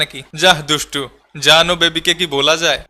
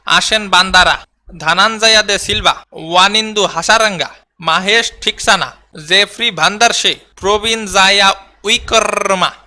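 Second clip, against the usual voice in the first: slow, deliberate reading of hard Sri Lankan names; careful name reading. Shown as a demonstration. A player reads the Sri Lanka squad aloud, slow and careful. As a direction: sound out each foreign name carefully. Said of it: "পইরা শোনা বেড়া".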